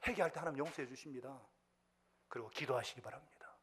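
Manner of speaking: ordinary delivery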